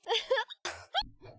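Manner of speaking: breathless giggling fit; helpless laugh. Real, not acted. Streamer cracking up over the hiccups, real laughter. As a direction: laugh it out, can't stop.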